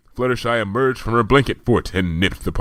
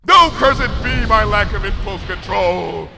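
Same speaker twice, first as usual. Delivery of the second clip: louder, slow, high theatrical curse; mock-tragic royal self-reproach; Luna's dramatic lament; 13 semitones higher, 7 dB louder than usual. A narrator's reading. Luna regretting her impulses, theatrical.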